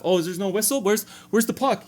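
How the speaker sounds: lightly hammed-up confused netminder; confused goalie. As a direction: play the lost goalie searching for the puck. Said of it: "He looks at the ref".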